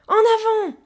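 high, loud battle call; rallying shout. A narrator's reading. Child at the wheel urges the gorilla on, shouting high. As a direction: holler the order to move off. Urging the car forward.

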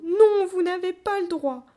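high, loud wailing objection; tearful protest. A narrator's reading. Child seized by the ankles, tearful protest. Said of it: "en éclatant en sanglots".

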